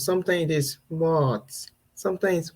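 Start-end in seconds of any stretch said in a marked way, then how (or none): 0.9-1.5 "What" at an ordinary pitch, gently falling; mild "What". Shown as a demonstration. Mom answering her husband's call, mild "What". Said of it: "It comes with different tones".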